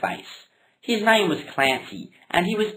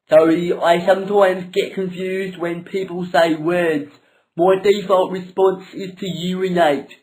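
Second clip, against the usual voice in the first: louder, odd put-on voice of a simple man; louder dopey character voice; dim Peter voice; 3 semitones higher, 6 dB louder than usual. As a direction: play a dopey guy apologising.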